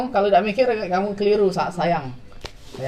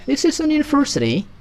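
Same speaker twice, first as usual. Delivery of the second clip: higher, posh British-sounding university; mock-elegant British accent; classy British; 4 semitones higher than usual. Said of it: "lebih berkelas".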